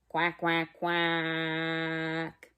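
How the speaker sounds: low, mock duck quack. Shown as a demonstration. Showing children how a duck quacks, low. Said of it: "Can you try that?".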